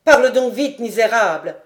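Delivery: loud, contemptuous command; angry cry. A narrator's reading. Lord pushes the lieutenant away, angry shout. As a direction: shove the words at him. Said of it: "s'écria Guy Hédert en le repoussant avec force".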